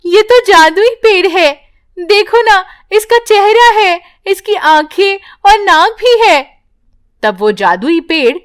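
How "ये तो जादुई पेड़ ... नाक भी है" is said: put-on high, excited girl's voice; a girl pointing out the tree's face; amazed child. Priya amazed at tree. (ऊँची, हैरान बच्ची की आवाज़)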